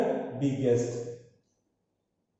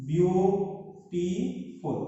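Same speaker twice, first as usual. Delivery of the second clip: beautiful drawn out slowly, beau-ti-ful; slow syllable split; 3 dB quieter, much slower than usual. Shown as a demonstration. Teacher slowly sounds out beautiful for students. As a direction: stretch beautiful out so each syllable is heard. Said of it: "in this word there are three syllables".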